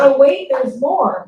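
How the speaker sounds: slow, loud pitchman hype; infomercial tone. Pretend. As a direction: sell it like a late-night ad. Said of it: "In the infomercial tone".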